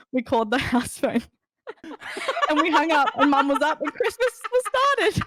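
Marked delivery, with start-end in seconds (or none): 1.8-5.3 helpless giggles as the story lands; laughing. Real, not acted. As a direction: tell it through real laughter. Laughs through telling the prank.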